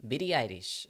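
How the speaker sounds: put-on English name in a Japanese accent. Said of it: "you have to pronounce anything that's English in, like, an accent".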